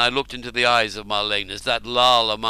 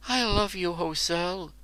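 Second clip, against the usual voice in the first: higher, soft loving voice; tender love; 7 semitones higher, 4 dB quieter than usual. Acted. Woman declaring love to the warrior, soft and high. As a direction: melt into the words. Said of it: "she said".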